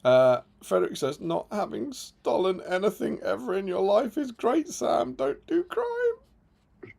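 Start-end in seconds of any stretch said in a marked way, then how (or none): 1.2-6.9 higher, very slow on don't do crime; mock preachy read. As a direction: stretch the last words sky-high. Reading a viewer's chat aloud.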